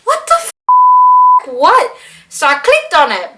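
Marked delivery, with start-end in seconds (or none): 0.0-2.0 high squeak of disbelief, slowed down; shocked. Retold or imitated. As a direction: gasp it out in shock. Reading the messages, shocked.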